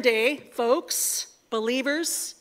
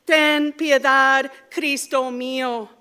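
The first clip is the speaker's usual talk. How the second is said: ordinary talk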